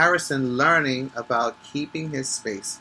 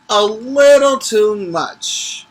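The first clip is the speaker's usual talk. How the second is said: drawn out, higher and louder on little; slow emphatic stretch; 7 semitones higher, 6 dB louder, slower than usual. A narrator's reading. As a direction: squeeze the words out slowly. Reading with dramatic emphasis.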